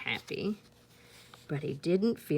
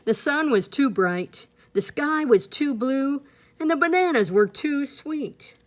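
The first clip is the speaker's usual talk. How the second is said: complaining; 7 semitones higher, 7 dB louder than usual. A narrator's reading. Complaining narration builds.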